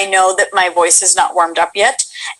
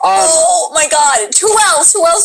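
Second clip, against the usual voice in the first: excited shout; 6 semitones higher, slower than usual. Real, not acted. Delighted by the impression.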